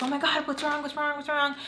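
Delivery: high, rapid-fire retold worry; mock alarm. Mom hearing daughter cry on phone, imitated alarm. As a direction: imitate a panicking mom, pitched well up.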